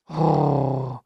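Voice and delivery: low dog growl. Acted. Dog Bob growls at the strict trainer, low growl. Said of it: "Bob gromt".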